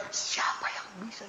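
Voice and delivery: hushed whispered question. Real, not acted. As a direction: whisper it to the hall. (bisikan pelan)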